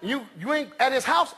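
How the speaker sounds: usual speaking voice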